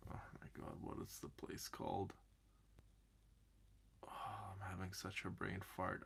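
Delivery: soft unvoiced mutter, trying to remember; muttering to self. Real, not acted. Muttering while trying to recall a place name. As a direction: whisper to yourself while searching.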